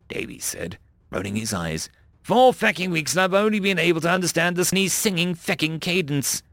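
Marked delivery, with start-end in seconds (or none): 2.2-6.4 high, griping soldier voice; exasperated Marine. A narrator's reading. Davies complaining about the cadence, exasperated. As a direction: grumble it with raised pitch.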